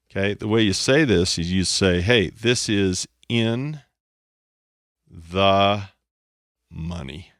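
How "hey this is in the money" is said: slow, laid-back cool delivery. Shown as a demonstration. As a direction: drawl it like a cool trader. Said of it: "but if you wanna be cool".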